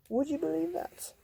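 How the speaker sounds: high amazed question; playful astonishment to the kids. Acted. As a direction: sound wide-eyed at the number. Amazed aside to listeners.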